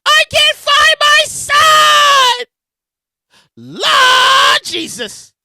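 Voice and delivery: high, loud, long-drawn mock wail; imitated screaming mother. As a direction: wail like a frantic mother in hammed-up panic. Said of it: "this lady starts screaming".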